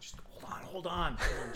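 hushed, half-whispered aside; whispering. Retold or imitated. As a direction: whisper it, covering the phone.

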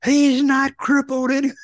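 high, excited mock commentary call; imitating Bob's call. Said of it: "I remember Bob called".

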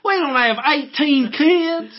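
hammed-up Southern yokel. Continuing the redneck act.

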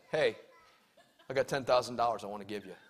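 fast, soft, low throwaway with a laugh; unserious offer. Shown as a demonstration. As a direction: mumble the offer so nobody believes it. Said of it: "your tone inflects your seriousness".

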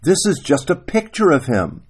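high-pitched, pleading explanation; mildly frustrated acted appeal; groaned protest. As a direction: plead as the exasperated husband. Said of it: "[name] groaned".

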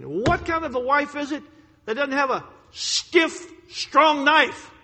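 loud, rising fury at the wife; building angry outburst about a flimsy knife; retold angry rant. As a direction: bark the complaint like a furious husband. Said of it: "And I got mad at her again".

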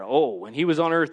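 normal talking voice

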